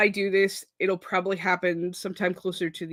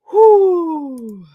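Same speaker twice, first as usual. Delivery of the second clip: exhale; 9 semitones higher, 10 dB louder than usual. Real, not acted. Big exhale after admitting sleeplessness.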